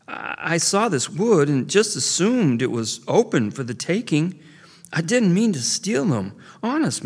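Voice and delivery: put-on high, pleading, stammering boy; scared young beaver. A narrator's reading. Frightened young beaver pleading with the old one, high and stammering. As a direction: beg him to believe you. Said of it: "still scared and startled".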